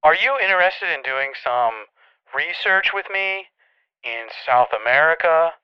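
ordinary talk

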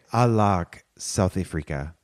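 slow, low mock South African accent. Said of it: "That's your South African accent?".

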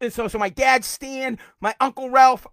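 ordinary talk, nothing marked

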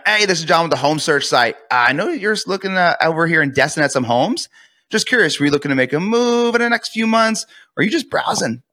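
easy, lifted questioning tone; curious tonality. Shown as a demonstration. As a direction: sound casually curious on a sales call. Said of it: "My tonality is a curiosity tonality".

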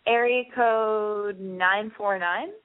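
slow, stretched-out caller announcement; announcer call-out. Real, not acted. Host putting the next caller on air, drawn-out announcement. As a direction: draw out the area code like a show host.

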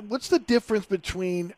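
normal talking voice

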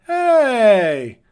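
retold excited greeting; loud hail. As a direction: call out across the bus to an old friend. Man hails him on bus.

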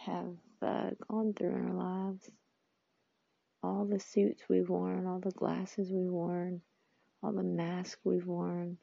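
usual speaking voice

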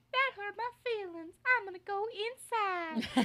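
squeaky little high voice; tiny high cartoon voice; high inner-child voice. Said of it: "Is that your inner voice?".

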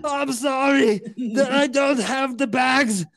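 hammed-up mock shout; put-on voice. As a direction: bellow it like a cartoon strongman. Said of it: "so I can do the voices".

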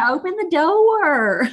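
put-on high, slow Southern child's drawl; childhood Southern accent. Redoing herself as a child, slow high Southern drawl. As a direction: stretch it like a little Southern kid. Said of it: "talk about a Southern accent".

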